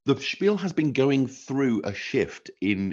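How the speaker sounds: ordinary talk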